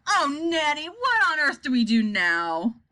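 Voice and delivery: wailing, drawn-out appeal; plaintive cry. A narrator's reading. President crying to Nanny for help, wailing. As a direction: cry out helplessly, slowing at the end. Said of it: "he cried".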